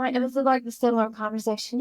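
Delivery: ordinary talk, nothing marked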